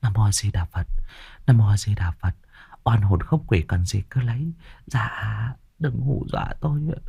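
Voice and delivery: soft, murmured Buddhist chant; fearful prayer. A narrator's reading. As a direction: pray softly, begging the ghosts. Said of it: "vừa đốt vừa khấn".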